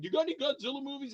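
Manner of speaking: mock pestering fan. Fan asking again.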